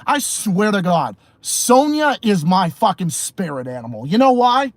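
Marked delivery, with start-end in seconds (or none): none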